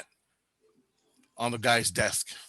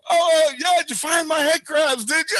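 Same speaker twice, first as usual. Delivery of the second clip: gleeful taunt; 13 semitones higher, 6 dB louder than usual. Retold or imitated. Taunting the scared man while laughing.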